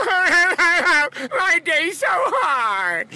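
high, loud, drawn-out laughing whine; whiny complaint. Retold or imitated. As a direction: wail it like a self-pitying husband. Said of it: "I would just whine to everybody".

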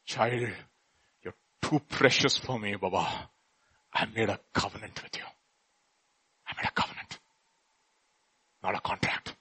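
soft and low, sinking to a whisper; tender voice of God. Acted. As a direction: comfort a precious child. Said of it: "Because he says".

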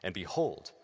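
normal talking voice

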